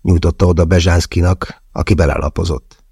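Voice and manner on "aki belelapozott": ordinary delivery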